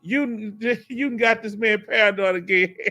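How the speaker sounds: words spilling out through real laughter; laughing while talking. Real, not acted. Teasing chat for making a viewer paranoid, laughing. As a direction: laugh as you tease. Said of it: "Y'all cutting up".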